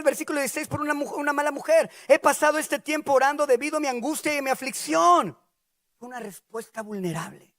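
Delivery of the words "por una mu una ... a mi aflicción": high, impassioned pleading; anguished defence. Acted. Ana defends herself to Eli, high impassioned plea. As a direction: pour out distress in self-defence.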